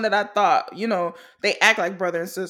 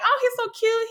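gushing; 12 semitones higher than usual. Retold or imitated. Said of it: "And I'm like".

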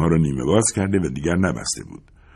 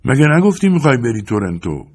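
cross, raised-pitch challenge; irritated shout; 7 semitones higher, 4 dB louder than usual. A narrator's reading. Bus driver questions his passenger about Toronto, shouting crossly. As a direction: snap the question in annoyance. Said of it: "با اوقات تلخی داد زد".

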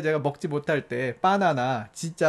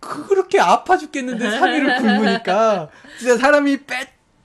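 emotional outburst; 6 semitones higher, 6 dB louder than usual. Retold or imitated. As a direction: voice rising high and loud, half laughing. Retelling three days of hunger.

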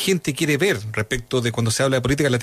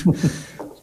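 stifled laugh; 3 semitones higher than usual. Real, not acted. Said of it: "estoy conteniendo la risa".

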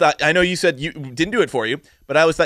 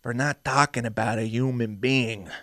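Yanni Gogolak impression; 2 semitones lower than usual. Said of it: "my impression of this character".

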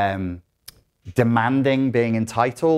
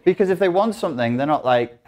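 ordinary delivery

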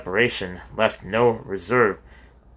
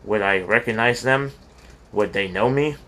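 ordinary delivery

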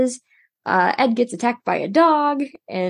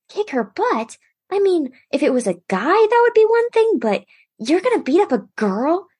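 high, swooping disbelief; incredulous objection; 9 semitones higher than usual. Retold or imitated. Al questions his brother's threat, high incredulous voice. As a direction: echo it back as a scandalised question, pitch leaping up. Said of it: "And Al says".

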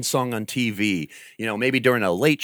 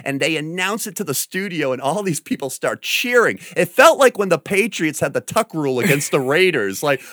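ordinary delivery

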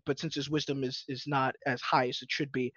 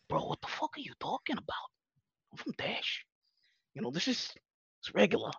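whispered, higher, as a hushed aside; 7 semitones higher, 7 dB quieter than usual. Retold or imitated. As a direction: whisper it back like a baffled outsider. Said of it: "And then I'm just like".

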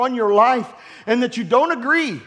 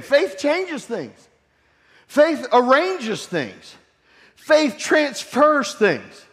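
slow, higher, drawn-out preaching cadence; 4 semitones higher, much slower than usual. Real, not acted. Preacher to congregation, slow drawn-out refrain. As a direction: lean on each line like a pulpit refrain.

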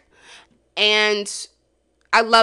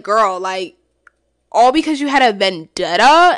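exasperated; 8 dB louder than usual. Retold or imitated. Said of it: "I was like".

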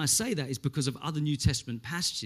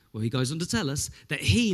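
ordinary talk

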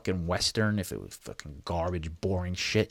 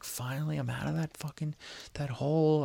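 higher-pitched mild mock elation; relieved exclaiming; 8 semitones higher than usual. Inmate on the phone after leaving the hole, mildly elated relief. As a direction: lift the pitch in light, acted relief. Acted relief at reaching Oklahoma.

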